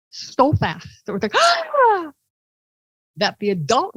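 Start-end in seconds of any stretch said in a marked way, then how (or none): 1.4-2.1 very high short shriek of shock; high startled little-girl shriek. Retold or imitated. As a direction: jump as if touched.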